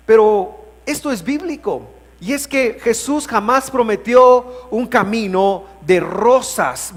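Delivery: high, forceful sermon voice; emphatic preaching. Real, not acted. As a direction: preach it out with conviction. Preaches with raised voice.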